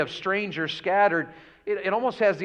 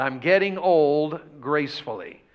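slow; 2 semitones lower than usual. Retold or imitated. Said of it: "He said that to me a lot".

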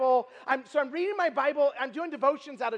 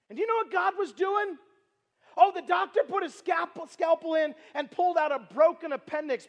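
higher, louder sermon climax; full-force preaching; 4 semitones higher, 3 dB louder than usual. Acted. Preacher proclaiming what God was doing, full-force preaching. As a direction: preach it hard to the crowd.

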